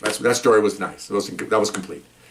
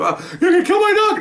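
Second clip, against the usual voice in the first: much higher, wailing mock panic; panicked owner; 4 dB louder than usual. Handcuffed man begs officers over his dog, high mock wail. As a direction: whine like a terrified big man.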